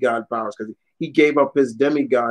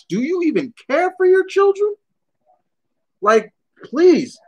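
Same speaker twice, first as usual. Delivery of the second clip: much higher, mocking disbelief; mock scolding Odin. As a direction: scold him like a disgusted father god. Imagined Odin scolding Zeus.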